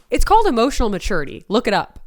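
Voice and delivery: fast, loud, sassy quip. Real, not acted. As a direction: snap it out with attitude.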